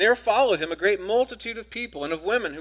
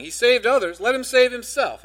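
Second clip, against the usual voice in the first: scoffing; 6 semitones higher, 6 dB louder than usual. A narrator's reading. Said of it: "the rulers scoffed at him".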